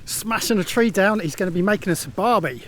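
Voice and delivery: mock Aussie accent. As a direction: play the bloke from the outback in a put-on Aussie accent. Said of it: "That's my Aussie accent".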